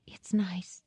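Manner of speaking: soft unvoiced whisper. Woman touched by the robe he gave her, whispering. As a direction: breathe it, almost to herself. She accepts the gift softly.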